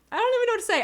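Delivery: high, loud overwhelmed cry; overwhelmed high exclamation; stunned squeal. Real, not acted. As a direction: burst out speechless. Stunned by gifts.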